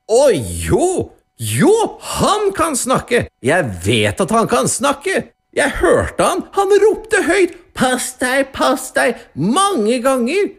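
very high, frantic protest; blurting without thinking. A narrator's reading. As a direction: insist wildly, voice shooting up. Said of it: "svarte den rike mannen uten å tenke".

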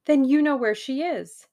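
high, loud, excited question; eager question. A narrator's reading. Tuppence asking Hersheimer where Jane is, eager.

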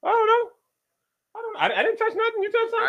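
mock innocent. Real, not acted. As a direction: play it like you didn't touch a thing. Denies touching the captions.

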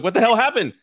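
high, loud outburst through a laugh; laughing disbelief. Retold or imitated. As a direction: blurt it out laughing, baffled at your own body. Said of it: "I'm like".

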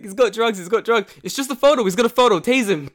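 high, loud, frantic shouting; hammed-up raid panic, urgent and repetitive; acted police alarm. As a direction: yell it like a mock police bust. Acts out police raid over photo.

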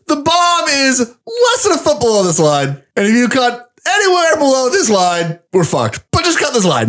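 high-pitched, strained instructions; mock-tense high voice; acted bomb-defusal briefing. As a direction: play it high and urgent, drawing out the key words.

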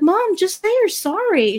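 a hint of exasperated kid; imitated pleading kid.

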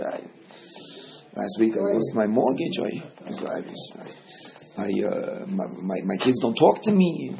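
mock self-pity, trailing off; mock complainer. Teacher imitating a man whining to the president, lightly mopey. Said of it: "If the president of the United States comes and says hello".

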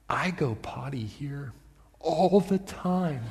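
put-on slow, soft, sly child voice; defiant little girl. Preacher voices a toddler lying to her dad, sly and slow. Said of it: "looks at him right in the eye and says".

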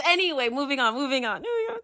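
high, soft words through laughter; giggling. Real, not acted. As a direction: try to move on while giggling. Giggling, trying to get back on track.

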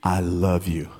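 very deep seductive rapper voice; hammed-up low loverman. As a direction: drop to the bottom of your register, wooing. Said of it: "[name] mode for a minute".

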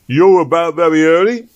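loud, high gruff constable voice; a stern London bobby challenging him; london policeman. Acted. Policeman voice in story.

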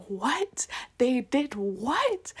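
stretched, scandalized questioning; retold disbelief. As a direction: draw it out in mock outrage. Acts out her disbelief at friend's news.

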